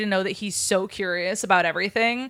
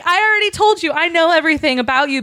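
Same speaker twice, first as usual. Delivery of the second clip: exasperated Harry; 8 semitones higher, 3 dB louder, faster than usual. Retold or imitated.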